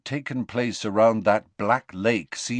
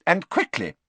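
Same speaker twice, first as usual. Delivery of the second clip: urgent McGonagall; 9 semitones higher than usual. A narrator's reading. McGonagall urges.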